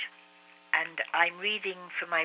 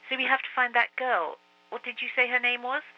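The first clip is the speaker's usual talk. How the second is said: put-on higher, eager young boy; boy's voice; 8 semitones higher, 4 dB louder than usual. A narrator's reading. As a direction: ask as a boy. Marcus asks.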